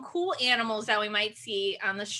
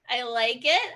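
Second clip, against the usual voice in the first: ordinary talk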